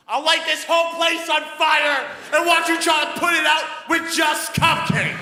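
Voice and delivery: high, full-throated threatening shout; ironically "calm" shouting. Retold or imitated. Customer threatening a cupcake seller, high furious shout. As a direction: scream the threat at the top of your voice. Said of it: "I said really calmly".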